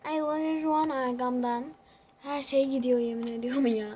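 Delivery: ordinary delivery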